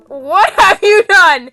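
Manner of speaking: slow, loud, stretched out disbelief; horrified shock. Real, not acted. Player reacts in horror to the misnamed character. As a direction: drag out the shock.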